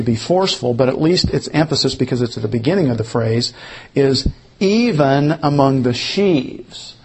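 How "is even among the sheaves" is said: "even" lifted and drawn out, slower; stress on EVEN. Shown as a demonstration. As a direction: lean hard on "even". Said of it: "The emphasis".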